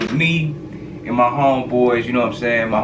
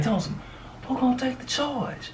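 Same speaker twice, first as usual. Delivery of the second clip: high, softer mock plea; imitating the mother; 14 semitones higher, 8 dB quieter than usual. Friend's mother asking him who takes the charge. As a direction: squeak it like a worried mom.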